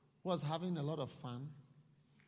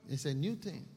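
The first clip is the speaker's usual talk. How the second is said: ordinary delivery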